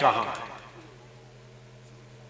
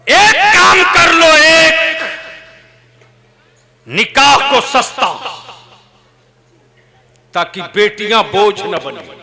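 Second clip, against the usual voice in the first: much higher and louder, shouted plea; fiery preaching; 10 semitones higher, 8 dB louder than usual. Real, not acted. Preacher pressing the crowd to make marriage cheap, fiery preaching. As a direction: hammer home the demand.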